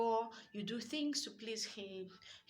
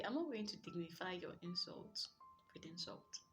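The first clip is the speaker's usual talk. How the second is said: soft, calm, much quieter reply; gently; 9 dB quieter than usual. Shown as a demonstration. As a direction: say it gently, almost under the breath. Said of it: "You can just gently say".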